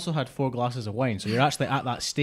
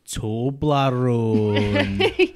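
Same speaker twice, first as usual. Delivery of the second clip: very slow, loud mock-Scottish drawl; slow Scottish accent; 7 dB louder, much slower than usual. Friend mocks a friend's attempt, slow Scottish accent. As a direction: stretch it absurdly in caricature Scottish. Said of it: "if she was in a Scottish accent".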